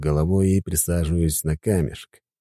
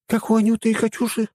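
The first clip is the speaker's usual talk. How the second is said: put-on young woman voice; faster than usual. A narrator's reading. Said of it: "уточнила Хельга".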